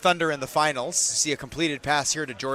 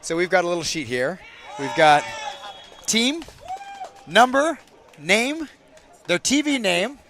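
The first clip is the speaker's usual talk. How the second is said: ordinary talk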